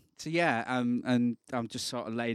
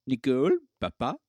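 louder, higher mock French; bad French accent; 4 semitones higher, 8 dB louder than usual. Host joking over the French beer name, bad French accent. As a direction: put on a hammy French accent. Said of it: "That's shit French accent".

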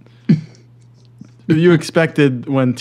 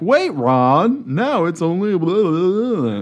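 mock announcer; 6 semitones higher, slower than usual. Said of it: "they're like".

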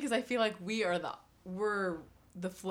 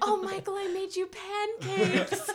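sweet princess voice; 11 semitones higher, slower than usual. Retold or imitated. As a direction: coo him awake like a fairy-tale princess. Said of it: "like a Disney princess".

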